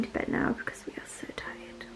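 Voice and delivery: soft tired whisper. Real, not acted. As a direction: hush it so no one wakes. Mother whispers back at bedtime.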